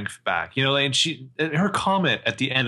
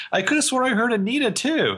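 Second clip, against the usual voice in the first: arch; 11 semitones higher than usual. Retold or imitated. Acting out Jenna's arch greeting.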